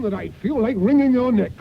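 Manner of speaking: angry outburst. Acted. Irate employer scolds gardener.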